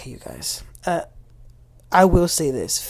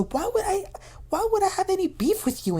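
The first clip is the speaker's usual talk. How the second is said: incredulous; 14 semitones higher than usual. Retold or imitated. Accused defends herself, baffled.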